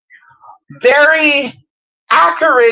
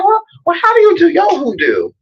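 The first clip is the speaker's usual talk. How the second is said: lightly voiced sing-song question, pitched higher; mock folksy greeting; 9 semitones higher than usual. Asking an Indiana practitioner, in a mock high sing-song. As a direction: tease them with a playful mock greeting. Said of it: "you say".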